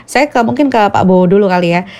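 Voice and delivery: normal talking voice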